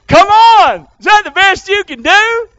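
high, loud preacher's challenge. Real, not acted. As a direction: throw it out loudly, incredulous. Challenging the rival theories from pulpit.

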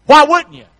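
high, loud emphatic repeat; loud preacher repeat; loud punch. Real, not acted. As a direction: hammer the phrase home loudly. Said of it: "preacher punches phrase".

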